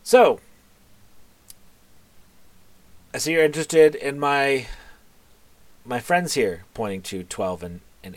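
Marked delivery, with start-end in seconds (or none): 0.0-6.6 high, loud opening "So," then a knowing pitch; a hint of a scheming merchant; sly Roderick. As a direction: Roderick voiced a touch higher and louder. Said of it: "he looks at him and goes".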